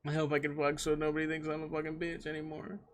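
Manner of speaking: soft, mock-nervous inner voice; nervous past self. Streamer quotes his nervous younger self with a girl, soft mock-anxious voice. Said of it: "I was like".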